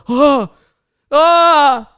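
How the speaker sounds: high piercing panic scream of a friend; panicked scream. Retold or imitated. Friend screaming at the restaurant table, high panicked shriek. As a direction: shriek like something just went badly wrong. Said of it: "a scream out of panic".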